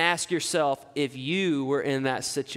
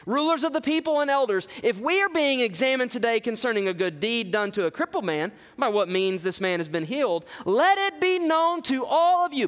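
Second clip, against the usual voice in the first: much higher, ringing proclamation building to a cry; fearless bold declaration; 6 semitones higher than usual. Shown as a demonstration. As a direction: declare it boldly to the rulers. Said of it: "You don't hear any fear whatsoever in Peter's words".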